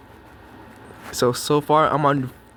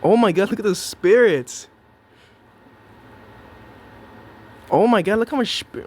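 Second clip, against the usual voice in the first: amazed; 6 semitones higher, 5 dB louder than usual. Real, not acted. Amazed at the spirits.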